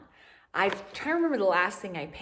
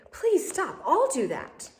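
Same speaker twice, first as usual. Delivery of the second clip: higher and louder, hurried to stop her; urgent maid; 8 semitones higher, 3 dB louder, slower than usual. A narrator's reading. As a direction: rush in to stop the princess. Maid stops princess doing chores.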